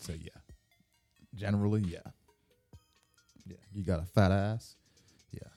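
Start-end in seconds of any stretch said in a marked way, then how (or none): none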